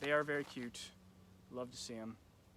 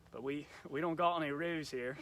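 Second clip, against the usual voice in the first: badly faked Australian accent, a touch louder; poor Australian accent; 3 semitones higher, 5 dB louder than usual. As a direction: attempt a hammy Australian accent. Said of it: "my poor Australian accent".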